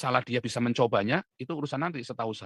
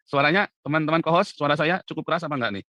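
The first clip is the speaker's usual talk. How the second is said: louder, projecting to prove the volume; loud on purpose; 4 semitones higher, 8 dB louder than usual. Real, not acted. To co-hosts complaining he whispers, speaking loud. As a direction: boost the voice so co-hosts hear. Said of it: "gua kerasin lagi nih".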